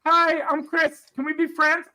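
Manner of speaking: high, loud sing-song fake friendliness; naive friendly mock. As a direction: chirp it like a clueless newcomer.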